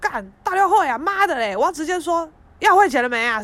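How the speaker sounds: angry outburst. Retold or imitated. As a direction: bark the demand for pay. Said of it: "很生气，就说". (朋友生气地大骂老板)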